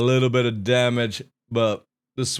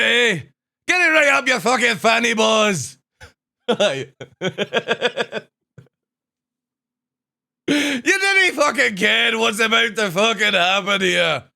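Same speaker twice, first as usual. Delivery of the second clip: high, loud hammed-up Scottish accent; rowdy Glasgow voice, played big; loud, rowdy Scottish banter; 10 semitones higher, 4 dB louder than usual. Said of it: "stream with full Scottish accent".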